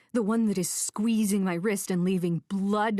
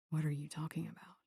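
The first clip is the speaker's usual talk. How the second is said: hushed question; 3 semitones lower, 11 dB quieter, faster than usual. A narrator's reading. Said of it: "he asks".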